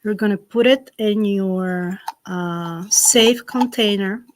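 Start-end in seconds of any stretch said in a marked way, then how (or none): none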